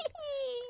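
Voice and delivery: high cackling witch laugh. Acted. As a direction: cackle like an old hag. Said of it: "My witch laugh for y'all".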